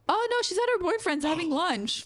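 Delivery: high-pitched, innocent blurting; imitated oblivious friend. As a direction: give away the secret in a high, carefree voice.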